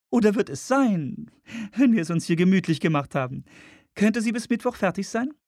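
hoch gesetzte Frauenstimme; Frauenfigur. Acted. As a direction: spiel eine Frau in hoher Lage. Woman voiced in narration. (hohe Frauenstimme)

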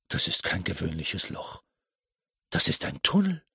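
thinks it, suddenly thrilled; eager whispered discovery; excited thinking. Acted. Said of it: "dachte aufgeregt".

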